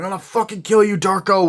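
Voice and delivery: loud, high angry shout; retold threatening yell; acted bully yell. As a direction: burst out like an attacking bully. Acting out bullies' threat.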